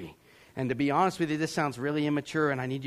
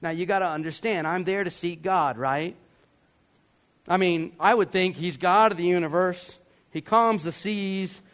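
usual speaking voice

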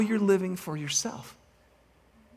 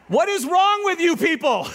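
high, louder, laughing in disbelief; laughing exasperation; 12 semitones higher, 3 dB louder than usual. Real, not acted. Preacher teasing congregation who missed the movie, laughing disbelief. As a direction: throw it at them half laughing.

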